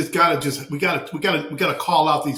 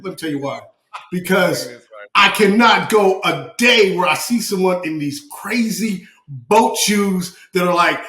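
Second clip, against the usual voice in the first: ordinary talk, nothing marked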